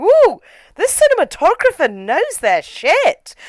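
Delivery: high, excited admiration; impressed whoop. Retold or imitated. Viewer recalling the gorgeous camera work, excited and high. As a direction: whoop with delighted approval. Acting out own impressed reaction while watching.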